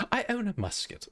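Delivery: high mock British; quick hammed-up British line; British accent try-out. Shown as a demonstration. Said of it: "I'll do a, uh, British".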